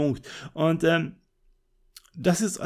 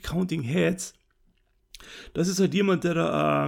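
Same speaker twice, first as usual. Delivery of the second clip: ordinary talk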